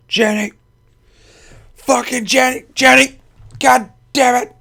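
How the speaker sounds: loud, high-pitched yell for someone; exasperated yelling. Real, not acted. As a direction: holler in frustration at someone not responding. Yelling out for Jenny in frustration.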